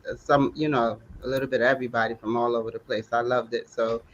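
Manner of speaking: normal talking voice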